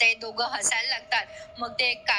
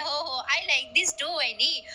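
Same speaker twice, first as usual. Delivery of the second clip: slow drawn-out catchphrase; 3 semitones higher, slower than usual. Retold or imitated. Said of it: "मग आता ते म्हणतात".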